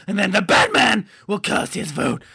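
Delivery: normal talking voice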